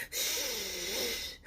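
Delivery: whispered hush; shushing whisper. Acted. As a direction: hush the crowd in the cinema. Shushing at the movies.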